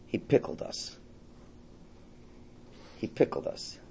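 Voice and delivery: soft, near-whispered punchline; a quiet, hushed repeat. Acted. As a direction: let it land almost under the breath. Punchline of the pickle parable.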